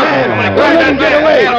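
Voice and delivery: high, loud shout to the guards; alarmed shout. Acted. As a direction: yell to stop the attacker. Assassination chaos, calling out.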